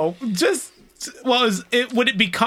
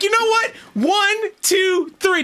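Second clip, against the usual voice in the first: high, emphatic mock referee count; excited count-out; 12 semitones higher, slower than usual. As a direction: call the count like a winning pin. Acting out a match count.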